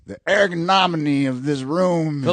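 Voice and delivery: hammed-up verbose talker; showily verbose. Shown as a demonstration. Drinker mocking his own fancy vocabulary, showily verbose. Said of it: "I'll start using, like, the".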